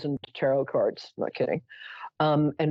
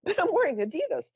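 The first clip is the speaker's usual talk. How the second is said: indignant protest; 10 semitones higher than usual. Retold or imitated. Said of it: "I'm like".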